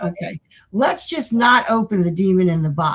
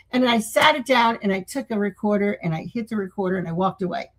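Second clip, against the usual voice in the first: ordinary delivery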